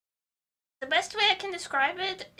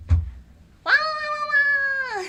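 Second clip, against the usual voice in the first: mock wailing; 9 semitones higher, 7 dB louder than usual. Wailing sounds before greeting.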